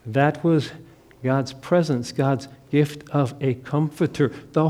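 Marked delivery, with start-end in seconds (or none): none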